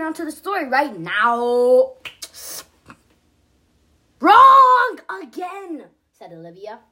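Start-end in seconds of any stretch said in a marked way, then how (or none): 4.2-5.9 Olivia's voice. Acted. Olivia character voice in story.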